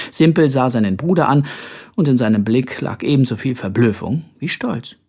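ordinary delivery, nothing marked

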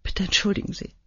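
soft tearful apology, breathy; tearful whisper. A narrator's reading. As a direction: apologize through tears, almost whispered. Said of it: "schnuffelte sie". (tränenerstickt geflüstert)

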